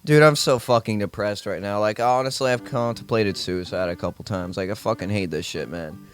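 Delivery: low, sinking, fading to a mumble; mock depressed. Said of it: "how would you think if I was just like".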